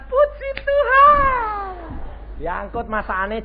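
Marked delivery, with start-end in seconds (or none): 0.1-1.7 very high, slow, drawn-out syrupy "Puji Tuhan"; mock pious praise. Pastor mocking the hungry guest with an exaggerated, drawn-out, high "Puji Tuhan". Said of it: "hamba Tuhan datang".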